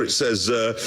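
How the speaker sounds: normal talking voice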